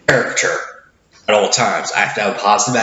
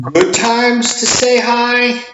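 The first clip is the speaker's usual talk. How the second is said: playful sing-song; 12 semitones higher, slower than usual. Acted. Stretched, sung-out phrase.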